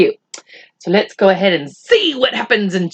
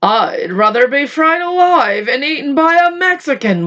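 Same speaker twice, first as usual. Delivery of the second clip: wailed; 5 semitones higher than usual. Acted. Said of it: "wailed the old green grasshopper".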